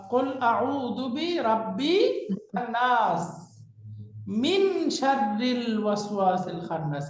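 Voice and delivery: slow, drawn-out verse recitation; Quranic recitation. Shown as a demonstration. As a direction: recite the verse unhurriedly, lengthening vowels. Teacher recites verses as example. (ধীর লয়ে আয়াত তিলাওয়াত)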